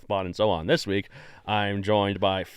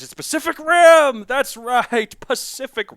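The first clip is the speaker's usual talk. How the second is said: much higher, louder announcement; triumphant reveal; 14 semitones higher, 9 dB louder, slower than usual. Acted. Host revealing Pacific Rim to listeners, loud and triumphant. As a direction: proclaim the title like a grand unveiling.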